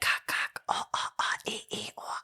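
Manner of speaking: whispered "cock, cock, oh, oh, oh" fading very soft; botched killer sound effect. Retold or imitated. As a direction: fumble the slasher chant, fading away. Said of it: "I'm, like, totally doing the sounds wrong".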